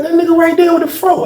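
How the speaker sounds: high, pointing him out; voiced homeboy. The homeboy pointing out the man across the hall, high and urgent.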